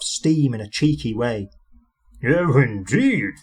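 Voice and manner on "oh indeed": slow, stern "Oh, indeed"; severe. A narrator's reading. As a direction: drag out "Oh, indeed" with stern disapproval. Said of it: "said the Fat Controller severely".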